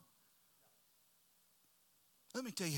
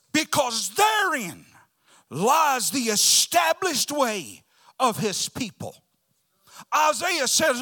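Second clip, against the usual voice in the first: loud, high revival-sermon delivery; impassioned preacher shouting the promise; full-force preaching; 11 semitones higher, 15 dB louder, slower than usual. Real, not acted. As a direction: thunder it out to the congregation.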